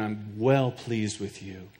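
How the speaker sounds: a gentle, slow word of blessing from God; tender voice of God. Acted. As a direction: soothe like a loving father. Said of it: "God says".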